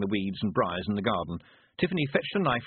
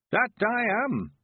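put-on high, slow creaky old countryman; old man voice; 13 semitones higher, 4 dB louder, much slower than usual. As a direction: play a cheerful old villager.

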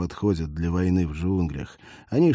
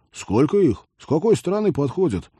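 urgent questions; 8 semitones higher, 5 dB louder than usual. Acted. Alarmed soldier demands info.

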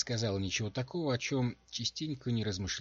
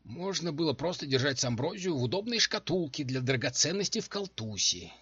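high, bitter complaint; 7 semitones higher, 5 dB louder than usual. A narrator's reading. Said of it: "с горечью произнесла та".

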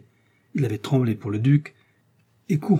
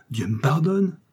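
higher-pitched, mildly outraged outburst; indignant exclamation; 5 semitones higher than usual. A narrator's reading. Buckingham answers what he takes for a threat, indignant exclamation. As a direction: exclaim it, taken aback. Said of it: "s'écria Buckingham".

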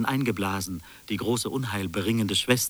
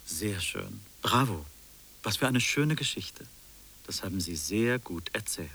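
ordinary talk

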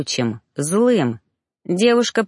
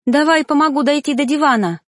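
high, louder, anxious offer; helping the hurt man, high and anxious; anxious urging; 9 semitones higher, 4 dB louder than usual. Acted. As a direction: urge him, worried. She urges helping him.

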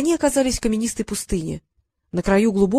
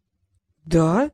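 low, surprised little query; a touch of puzzled surprise; 4 semitones lower than usual. A narrator's reading. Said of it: "удивился Щавель".

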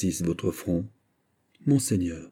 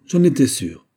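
higher, louder outburst; exclamation; 6 semitones higher, 7 dB louder than usual. A narrator's reading. Pélisson, his suspicion confirmed, exclaims loudly. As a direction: cry it out with certainty. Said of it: "s'écria Pélisson".

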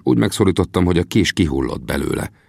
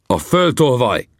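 angry accusation; 6 semitones higher, slower than usual. A narrator's reading. Chief accuses narrator as he attacks.

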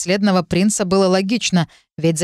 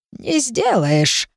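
very slow, higher, calm crone; calm old witch; 4 semitones higher, much slower than usual. Acted. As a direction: unshaken witch, slow and certain. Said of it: "сказала ведьма спокойно".

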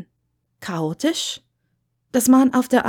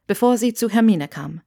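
usual speaking voice

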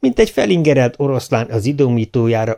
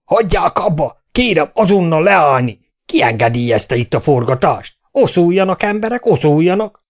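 higher, louder furious orders; angry mayor shouting; 7 semitones higher, 4 dB louder than usual. A narrator's reading. Mayor storms in, ordering the film crew away, furious shout. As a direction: shout the crowd apart, raging. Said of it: "Haragos hangja már messziről hallatszott".

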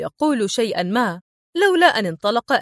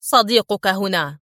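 a clipped, gloating reply; tense sternness. A narrator's reading. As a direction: hit him with it coldly. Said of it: "في صرامة عصبية". (صرامة متوترة)

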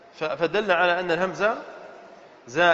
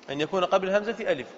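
fast run-through of the rule; brisk recap; quick repeat; faster than usual. Shown as a demonstration.

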